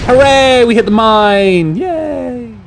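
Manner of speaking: high, drawn-out hooray, fading off; long high sing-out of pretend celebration; mock cheering. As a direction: cheer like a silly crowd, tailing away. Mock cheering at a mine hit.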